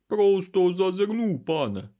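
high, slow, drawn-out odd voice; strange creature voice. A narrator's reading. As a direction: stretch it out like an uncanny little servant. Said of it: "тихо промовив той голосом, дивно не схожим на його звичне верескливе кавкання".

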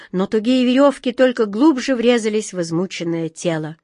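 ordinary delivery, nothing marked

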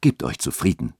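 low, soothing reassurance; moved, touched. A narrator's reading. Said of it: "sagte dieser gerührt".

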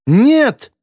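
high-pitched cry of horror; horrified cry. A narrator's reading. Girl learns of a loved one's death, horrified cry. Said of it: "с ужасом выкрикнула Стелла".

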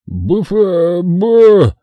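loud gagging burst; dry heaving; retching noise. A narrator's reading. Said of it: "Издав неприличный звук". (рвотный позыв)

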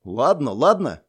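slow, drawn-out giving in; hasty placating. A narrator's reading. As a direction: wave her off, placating. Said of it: "поспешил согласиться Прут". (поспешно соглашаясь, примирительно)

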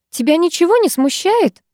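high, mildly displeased question. A narrator's reading. Girl asked to dance in rain, displeased. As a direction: object with a high, questioning lilt. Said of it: "недовольно заметила я".

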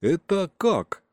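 high, drawn-out puzzled question; bewildered. A narrator's reading. Boy turning to the uncle who fired, bewildered. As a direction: stretch the question out in disbelief.

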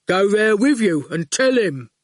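put-on loom over the boy and state it plainly. A narrator's reading. Goon grinning at the boy outside school, lightly hulking.

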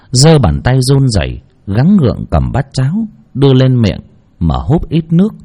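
ordinary delivery, nothing marked

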